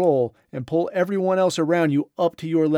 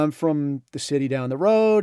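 normal talking voice

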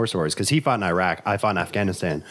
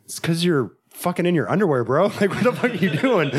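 higher, laughing through the punchline; laughing retort; 8 semitones higher than usual. Retold or imitated. Telling the guy about his underwear, higher and laughing. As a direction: crack up while quoting the jab. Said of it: "He goes".

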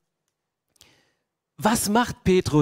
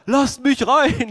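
higher, louder, slow call at the door; calling to be let in; 7 semitones higher, 7 dB louder, slower than usual. Retold or imitated. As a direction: shout through the door to be let in. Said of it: "Petrus klopft weiter".

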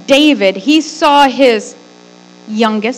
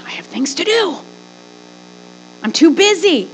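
whining excuse; 9 semitones higher, faster than usual. Acted. Voices people's excuses.

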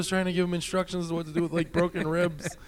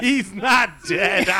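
higher, louder, slow, laughing through the words; maniacal laugh; 4 semitones higher, 6 dB louder, much slower than usual. Retold or imitated. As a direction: cackle it like a madman. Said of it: "laughing at her ma-, maniacally".

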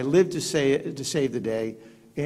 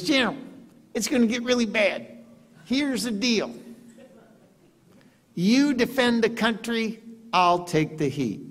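higher put-on president voice, slowing at the end; the president's voice; 9 semitones higher than usual. President promising to take the heat.

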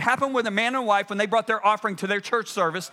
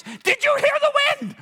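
very high-pitched mock scream; shrill acted shriek of a question; imitated scream; 4 dB louder than usual. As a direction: imitate a woman screaming in agitation. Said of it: "She screams".

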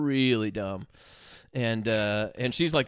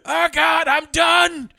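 very high, loud mock death cry; hammed-up yell; 13 semitones higher, 8 dB louder, much slower than usual. Man yelling as he burns, hammed-up yell. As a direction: holler in comic agony. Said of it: "is yelling when he's burning".

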